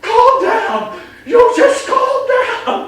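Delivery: loud, high, slow hammed-up "calm down!" with a laugh; mock yelling. Shown as a demonstration. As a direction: overdo the panicked owner. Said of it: "you can't be going".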